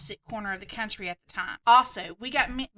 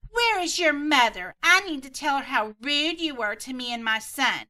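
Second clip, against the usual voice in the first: entitled Karen; 4 semitones higher, 7 dB louder than usual. Acted. Karen demands the mother.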